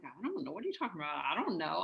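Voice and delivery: hushed, innocent shrug of a reply; feigned innocence. Retold or imitated. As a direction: dodge dad's question with mock innocence. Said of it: "I kind of feigned innocence".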